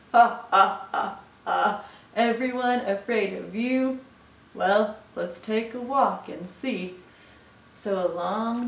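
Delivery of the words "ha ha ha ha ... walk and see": loud, spaced mock laugh, then scoffing; scoffing Gruffalo. Gruffalo doubts mouse's boast, scoffing. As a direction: laugh it off in big separate ha's.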